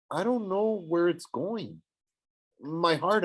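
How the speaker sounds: ordinary delivery, nothing marked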